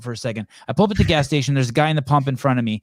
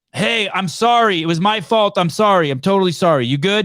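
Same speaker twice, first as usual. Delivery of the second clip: talking louder; 5 semitones higher, 4 dB louder than usual. Retold or imitated. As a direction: raise the voice so a hard-of-hearing man catches it. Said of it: "I talk louder".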